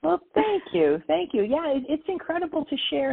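light up with gratitude; beaming, lifted thanks; touched, bright thanks. Real, not acted. Thanked after moving praise.

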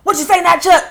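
high, loud comic bark; Spice Adams impression. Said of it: "is literally Spice Adams".

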